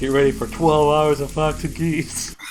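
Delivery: loud, slow doom voice; drawn out loud mock warning; loud mock dread. Repeats phrase, loud slow dread.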